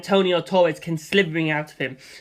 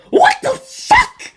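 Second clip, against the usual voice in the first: very high, loud, slow screech; high villain screech; outraged villain; 8 dB louder, much slower than usual. Acted. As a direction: shriek in disbelief. Antonio outraged.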